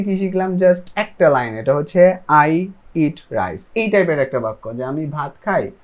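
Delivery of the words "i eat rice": each word slow and separate; slow model sentence. Shown as a demonstration. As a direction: spell it out slowly for learners. Teacher models English sentence.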